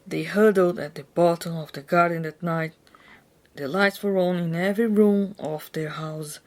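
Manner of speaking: usual speaking voice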